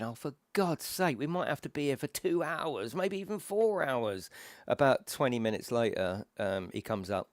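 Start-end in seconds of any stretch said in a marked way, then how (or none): 0.0-4.3 mild retold exasperation, rising in pitch; exasperated. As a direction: grumble at the prospect of waiting. Exasperated thoughts while stuck on plane.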